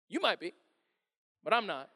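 calm, offhand denial; easy, understated refusal; unbothered. Acted. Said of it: "he didn't get defensive".

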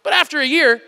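ordinary delivery

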